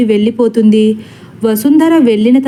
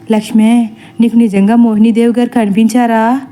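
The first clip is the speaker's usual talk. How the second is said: usual speaking voice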